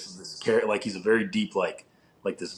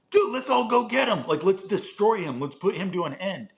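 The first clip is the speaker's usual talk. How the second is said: put-on much higher, pushy young fighter rallying the team; hammed-up Trunks itching to destroy Cell; eager Trunks; 9 semitones higher than usual. Trunks voiced, urging attack.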